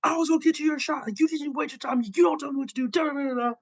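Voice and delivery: high-pitched, hurried imitation of fuming; mock pissed-off rant. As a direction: rattle off a complaint, trailing into nonsense syllables. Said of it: "Roman's doubly pissed 'cause he's like".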